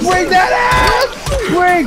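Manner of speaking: hyped yelling. Real, not acted. Fighting zombies.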